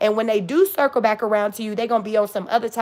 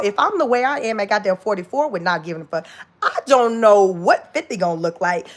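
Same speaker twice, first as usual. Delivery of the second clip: ordinary talk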